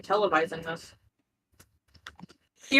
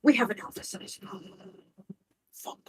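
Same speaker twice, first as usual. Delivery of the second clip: whispering; 8 dB quieter than usual. Acted. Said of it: "I'm whispering him".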